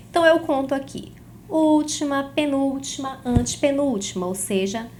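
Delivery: usual speaking voice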